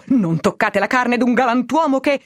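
high-pitched protest, little added volume; restrained narrated cry. A narrator's reading. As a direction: cry out to fend off rough hands. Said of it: "quando egli gridò". (grido di protesta acuto ma trattenuto)

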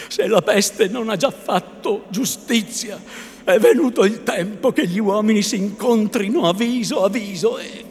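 loud high rising shout of vengeance; climbing furious shout; blind fury. A narrator's reading. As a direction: build to a roar of revenge. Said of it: "cieco affatto dalla collera".

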